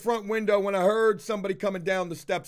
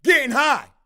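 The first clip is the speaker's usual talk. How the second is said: loud furious accusation, pitched higher; angry; 5 semitones higher, 6 dB louder than usual. Retold or imitated. Homeowner yelling at the worker for getting high, loud and furious. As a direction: yell the accusation in his face. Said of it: "I said".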